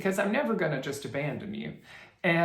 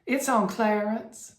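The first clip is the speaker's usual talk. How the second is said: Clarence in a slow Southern accent, a little higher; Southern drawl, Clarence; 5 semitones higher, 4 dB louder, slower than usual. Shown as a demonstration. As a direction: drawl It's on Clarence Southern style, a touch high. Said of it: "with a Southern accent".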